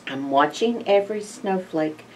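ordinary delivery

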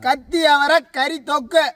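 high, drawn-out announcing call; shouting style. Shown as a demonstration. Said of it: "நான் கத்தி சொல்ற ஸ்டைலே". (கத்திச் சொல்ற style)

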